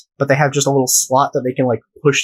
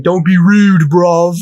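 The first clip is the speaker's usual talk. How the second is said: raised, louder mock-London protest; mock British accent; 3 semitones higher, 5 dB louder, slower than usual. Some guy to the McDonald's guy, mock British yelling. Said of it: "very thick British accent".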